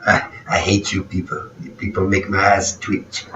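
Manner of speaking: hushed mock French complaint; a lightly voiced spiteful Frenchman; mocking Meg Ryan. Host quoting Meg Ryan's mock complaint, hushed. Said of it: "That was Meg Ryan making fun".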